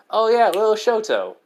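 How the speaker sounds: high, loud burst of remembering; light up at the memory, pitched high; excited recollection. Acted. Izuku remembers his little brother, excited and high.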